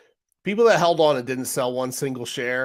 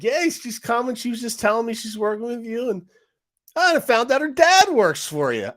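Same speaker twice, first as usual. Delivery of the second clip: mock chummy congressman; 11 semitones higher, 4 dB louder than usual. Congressman voiced in retold hearing.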